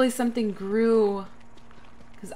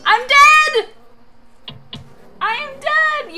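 high, loud despairing scream; 12 dB louder, slower than usual. Real, not acted. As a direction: shriek at dying. Character dies in game.

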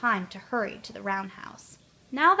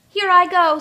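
much higher and louder, bursting off; eager Tootle; 9 semitones higher, 13 dB louder than usual. A narrator's reading. Tootle to himself starting the race, excited and loud. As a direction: launch into the race with glee. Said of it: "said Tootle to himself".

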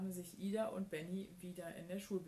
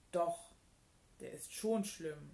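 emphatic, sulky contradiction; defiant; 4 dB louder than usual. A narrator's reading. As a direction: hit the first word hard, stubbornly. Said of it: "sagte Ida trotzig". (trotzig widersprochen)

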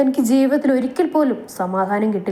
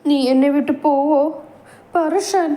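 pitiful plea; 6 semitones higher than usual. Acted. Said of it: "ദയനീയ ഭാവം വരുത്തി ചോദിച്ചതും".

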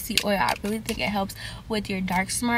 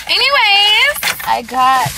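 high, loud, stretched-out word; loud transition; 10 semitones higher, 10 dB louder, much slower than usual. Real, not acted. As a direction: belt it out long to change the subject. Snaps back from accent play.

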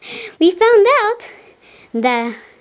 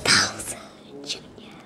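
menacing whisper; 12 semitones lower, 12 dB quieter than usual. Pretend. Villain whispers threat in play.

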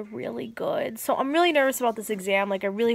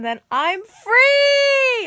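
ecstatic shout; 9 dB louder, much slower than usual. Real, not acted. Last class done, celebrating.

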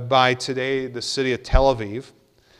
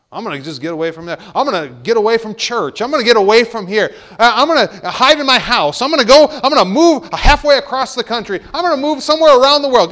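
higher and louder, building into a frantic rush; hammed-up rising panic of a man on the run; runaway believer; 8 semitones higher, 10 dB louder than usual. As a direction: act out someone bolting from God, voice climbing. Preacher voicing a runaway believer.

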